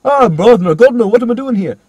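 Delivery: put-on high, loud accent veering into Jamaican; badly wandering Irish accent gone via Kingston; mock Jamaican accent. Imitating the student's worst line, loud mock Jamaican. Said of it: "That last one's via Kingston".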